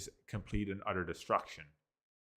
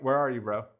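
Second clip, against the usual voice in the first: a light casual bro voice, a bit louder; 4 semitones higher, 6 dB louder, faster than usual. Retold or imitated. Showing how a normal person would really say it. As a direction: toss it off like texting a friend. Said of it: "It's more like".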